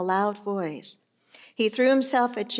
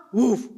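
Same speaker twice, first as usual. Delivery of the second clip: short, loud mock woof; dog bark; 5 dB louder than usual. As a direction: answer with a punchy dog bark. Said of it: "The dog barks".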